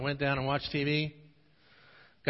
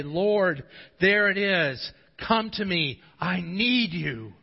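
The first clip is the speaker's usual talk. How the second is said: raised, urgent plea to God; pleading prayer; 4 semitones higher, 6 dB louder, slower than usual. Acted. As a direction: cry out to the Lord, slowing on the last words. Preacher voices a pleading prayer.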